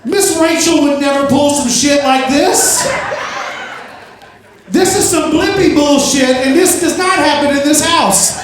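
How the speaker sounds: high-pitched indignant mock scolding; acted meltdown. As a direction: lay down the law in comic outrage. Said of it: "I lost my shit".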